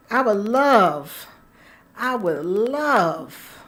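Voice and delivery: LOVE stretched long with a swooping fall; savouring. Real, not acted. Host relishing a fantasy, savouring LOVE. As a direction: draw out LOVE slowly, relishing it.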